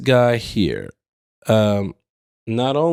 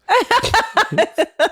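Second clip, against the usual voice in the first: high giggle. Real, not acted. Laughing at his own reading.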